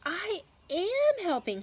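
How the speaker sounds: high, loud, slow little boast from the peacock; the peacock beaming at his own idea, voiced high and slow; proud peacock. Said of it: "he thought".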